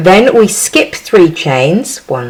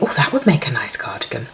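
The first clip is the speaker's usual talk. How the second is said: soft, unvoiced whisper of an idea; whispered thought; 5 semitones lower, 6 dB quieter, faster than usual. To herself, dreaming up a cardigan, whispered. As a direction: breathe it like a private thought. Her own thought while crocheting.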